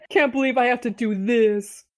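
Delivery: mildly forlorn cat muttered complaint; mock forlorn. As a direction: play a mildly dejected pet, resigned to it. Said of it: "Forlornly? ... looking up like".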